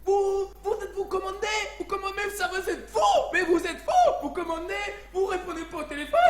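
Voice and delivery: very high, shouted fake Chinese accent; angry mock Chinese accent. Restaurant man scolds customer by phone, furious mock Chinese accent. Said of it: "le mec était un peu vénère".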